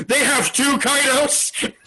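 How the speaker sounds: high, slow stunned outcry; hammed-up incredulous cry; mock shock. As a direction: blurt it high in drawn-out disbelief. Samurai reacting in shock.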